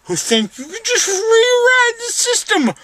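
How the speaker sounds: high, loud wail; crying outburst. Real, not acted. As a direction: wail through tears. Drugged crying speech.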